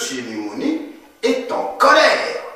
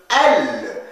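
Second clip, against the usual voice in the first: ordinary delivery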